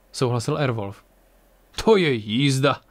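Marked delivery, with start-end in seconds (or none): 1.8-2.8 mildly amused remark. A narrator's reading. Ang reacts to the crew's odd luck, amused. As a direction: marvel with a touch of amusement. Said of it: "řekl Ang pobaveně".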